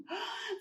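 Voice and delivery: sharp, theatrical intake of breath; shocked gasp. Acted. Hen shocked.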